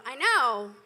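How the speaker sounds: ordinary delivery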